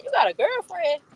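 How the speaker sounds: mock surprise. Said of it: "I'm like".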